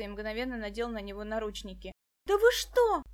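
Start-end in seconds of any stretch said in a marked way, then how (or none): 2.3-3.0 much higher, louder outraged cry; girl shrieking at the policeman; shouting. A narrator's reading. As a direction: yell in disbelief. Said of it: "закричала Даша".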